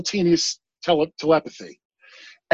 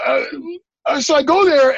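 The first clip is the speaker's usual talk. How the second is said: ordinary talk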